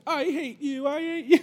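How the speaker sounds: put-on high, wailing little-kid voice; crying kid yelling. Hurt preschooler wails at the man who bumped him, high child voice. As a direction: whine it like a hurt child throwing a fit. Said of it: "And he's just like".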